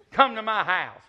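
put-on bossy old woman on the phone; mock stern elderly lady; old lady's command. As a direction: order him over, curt and commanding. Repeats her phone command.

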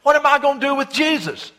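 an exasperated ruler begging the crowd for an answer; Pilate pleading. Retold or imitated. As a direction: Pilate asks the mob what to do with Jesus, high and loud. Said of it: "and then Pilate".

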